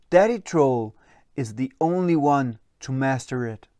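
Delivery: ordinary delivery, nothing marked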